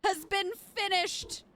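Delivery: real excitement; triumphant cheer. Real, not acted. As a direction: crow about finishing the task. Triumph after finishing jam task.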